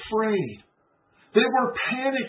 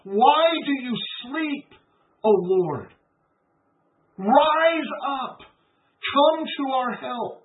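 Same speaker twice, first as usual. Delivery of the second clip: crying out to God; 4 semitones higher, slower than usual. Retold or imitated. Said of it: "he cries out to God".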